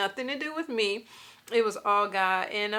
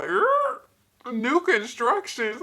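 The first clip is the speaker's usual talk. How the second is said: stunned disbelief; 3 semitones higher, 4 dB louder, much slower than usual. Retold or imitated. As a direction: repeat it like you can't believe it. Shocked at new construction.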